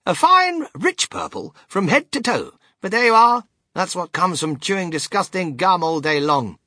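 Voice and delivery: high, loud, theatrical verdict; Willy Wonka, exclaiming. Acted. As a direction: savour it, then scold briskly.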